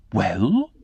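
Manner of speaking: put-on teasing, taunting girl. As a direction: taunt him with one word. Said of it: "in her taunting manner".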